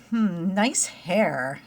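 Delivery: Hmm, nice hair drawn out very slowly, sarcastic. Retold or imitated. Mom imitating a girl mocking his haircut, slow and sarcastic. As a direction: drag out Hmm, nice hair like a backhanded compliment. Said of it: "how people talk and tones of voice".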